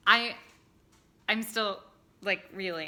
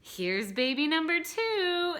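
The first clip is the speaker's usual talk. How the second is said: slow, higher, teasing reveal; technician's reveal; 9 semitones higher, 5 dB louder, slower than usual. Retold or imitated. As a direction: drop the news lightly. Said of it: "And she goes".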